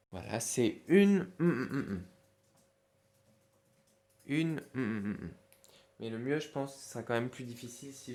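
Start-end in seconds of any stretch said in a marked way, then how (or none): 1.4-2.1 loud string of short hums; humming for a blank. Shown as a demonstration. Teacher quizzing pupils on the erased word, humming. 4.8-5.3 short hums falling away; humming for a blank. Shown as a demonstration. Teacher repeating the gap for pupils, humming. Hums in place of hidden word.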